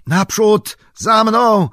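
loud, high rallying cry over the shoulder; full-throated call to follow; shouting. Acted. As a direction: yell it back to the others, urging them on. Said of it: "krzyknął przez ramię".

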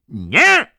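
high, loud terrified scream. A narrator's reading. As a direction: shriek out in panic. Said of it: "krzyknął George". (przerażony, wysoki krzyk)

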